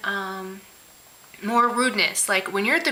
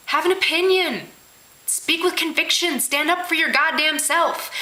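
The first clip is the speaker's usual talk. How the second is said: emphatic, higher-pitched demand; exasperated command; 7 semitones higher than usual. Real, not acted. Ranting at indecisive people, exasperated and commanding. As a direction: drive each command home, pitched up.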